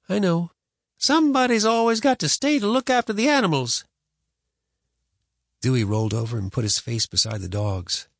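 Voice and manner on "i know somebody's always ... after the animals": put-on higher, resigned boy; boy's voice. A narrator's reading. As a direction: sigh it like a dutiful boy.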